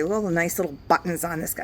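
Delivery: ordinary talk, nothing marked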